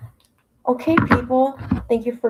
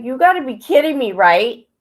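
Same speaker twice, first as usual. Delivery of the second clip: higher, louder mock outburst at her dad; retold outburst; 5 semitones higher, 9 dB louder than usual. Retelling her reply to her dad, higher and louder. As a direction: throw it back at him, indignant. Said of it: "I'm like".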